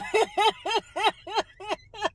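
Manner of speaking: high cackling laugh; helpless laughter; long disbelieving laugh. Real, not acted. Reacting to the date change.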